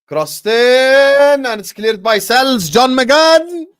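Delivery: loud, high, stretched match call; mock football commentary shout. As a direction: shout the play like an excited commentator.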